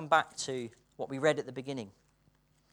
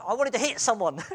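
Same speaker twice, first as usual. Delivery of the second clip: excited Kenyan; 7 semitones higher, 8 dB louder than usual. Retold or imitated. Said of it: "he said".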